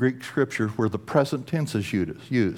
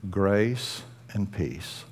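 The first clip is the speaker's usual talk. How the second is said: soft, slow blessing; 4 semitones lower, 7 dB quieter, much slower than usual. Acted. Answers with God's greeting.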